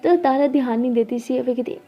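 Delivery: plain reading voice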